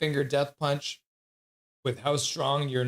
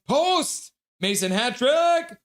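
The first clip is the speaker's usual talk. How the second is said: high, loud game call; loud play-by-play outburst; excited shout; 11 semitones higher, 5 dB louder, slower than usual. Real, not acted. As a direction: call it like a hype moment. Reacting to live game play.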